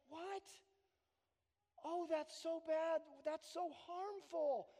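gently shocked at the harm; compassionate surprise. Retold or imitated. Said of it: "you're like".

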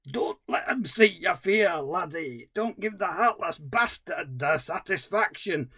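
imitating the film dog's awful Scottish accent; bad Scottish accent. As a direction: put on a hammy, badly faked Scottish accent. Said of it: "attempt how bad the accent is".

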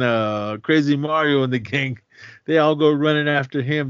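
usual speaking voice

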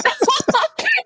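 short high-pitched laugh; amused high laugh; high giggle. Real, not acted. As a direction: let a bright giggle escape. Laugh after punchline.

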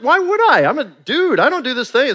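high, indignant why would I; incredulous. Retold or imitated. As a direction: protest like a baffled new dad. Defensive new dad retort.

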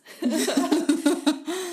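loud, high burst of laughter; big laugh. Real, not acted. Co-host amused at talk of raising your voice, big laugh.

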